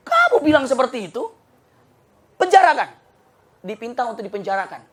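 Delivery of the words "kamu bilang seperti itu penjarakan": high, loud furious order; angry king. Acted. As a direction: bark the order to jail him.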